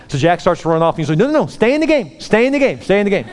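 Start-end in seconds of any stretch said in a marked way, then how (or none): 1.2-3.3 high, insistent, repeated order; urgent urging. Retold or imitated. Coach stops Jack running off, urgent urging. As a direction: wave him back in, voice pitched up. Coach urges player back in.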